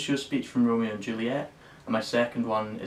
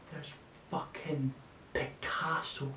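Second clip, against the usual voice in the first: slow, hushed disbelief; awed whisper; 3 semitones higher, 10 dB quieter, slower than usual. Acted. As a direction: breathe the name out in awe. Stunned discovery of Picasso photo.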